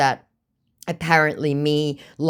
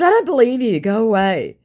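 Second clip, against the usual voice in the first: higher, louder brush-off; dismissive retort; 9 semitones higher, 6 dB louder than usual. Retold or imitated. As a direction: send him away curtly. Original reply vs recap.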